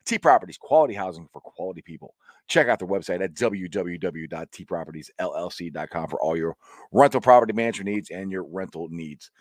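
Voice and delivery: brisk sponsor read, quickened on the slogan; radio sponsor plug. Acted.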